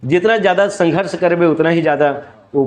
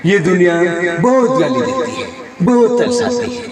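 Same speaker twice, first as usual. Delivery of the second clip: Jeetendra; 3 semitones higher than usual. Acted. Hero pleading with Gauri about the world, Jeetendra impression. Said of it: "ये जितेंद्र जी के।".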